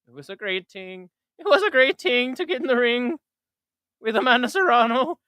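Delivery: Irish with ting for thing, voice pitched high; a hint of Katie Taylor's Irish lilt; mock Irish accent. Streamer redoing his Katie Taylor line in Irish accent. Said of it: "I should have said more like".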